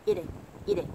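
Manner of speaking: "Eating" as "ee-ding", light quick tap for t; flapped T. Shown as a demonstration. Teacher repeating for learners, American flap in Eating. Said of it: "đọc na ná giống như âm flap".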